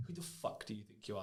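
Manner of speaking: soft, hushed menacing repeat; a quiet hint of the boss's nastiness; nasty boss. Repeating the boss's nasty words to hosts, softly menacing. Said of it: "really nastily".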